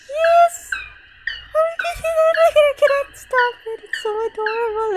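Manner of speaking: squealing delight. Real, not acted. Gamer squealing at cute animals on screen. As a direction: squeal at something adorable.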